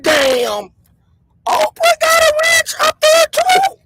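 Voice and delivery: very high, squeaky-pitched incredulous question; stunned, high-pitched exclamation; astonished disbelief. Retold or imitated. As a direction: retell your shock with the voice shooting way up. Retold astonished reaction to the news.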